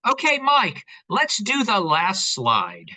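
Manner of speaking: loud and slow, called across the room; calling out. Real, not acted. Calling to Mike at the back for the next slide, loud and slow.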